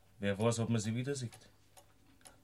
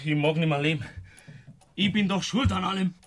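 louder, higher cry of guilt; despairing outburst; 5 semitones higher, 6 dB louder than usual. Acted. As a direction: blurt it out in desperate self-blame.

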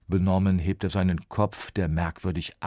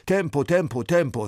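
loud, higher urging call; hurrying call; 10 semitones higher, 7 dB louder than usual. A narrator's reading. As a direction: clap them along, hurry up. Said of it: "klatscht in die Hände".

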